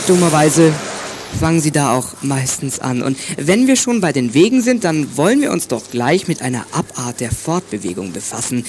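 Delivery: normal talking voice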